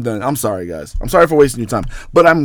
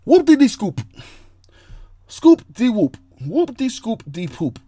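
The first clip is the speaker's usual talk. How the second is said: high-pitched nonsense rap voice; mock rapper; 9 semitones higher than usual. Host showing off his SoundCloud bars, mock-rap squeak. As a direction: spit silly scat bars up high.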